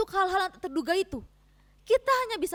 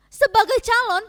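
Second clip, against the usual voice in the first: higher, much louder scornful question; angry challenge; 5 semitones higher, 8 dB louder than usual. Acted. Wife confronting husband about his empty promise, angry and loud. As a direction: demand an answer, voice rising in anger.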